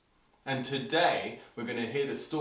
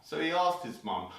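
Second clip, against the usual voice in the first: plain reading voice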